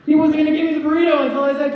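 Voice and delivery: high, loud, fast outburst; indignant yelling. Acted. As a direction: yell it in comic outrage. Indignant climax of burrito story.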